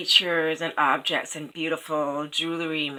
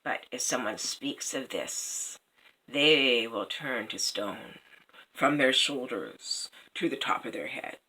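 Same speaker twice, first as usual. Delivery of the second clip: mocking raven; 3 semitones higher, 8 dB louder than usual. A narrator's reading. Third raven mocking the prince, loud then hushed. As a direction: sneer at the fools then whisper the doom. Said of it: "the third raven spoke".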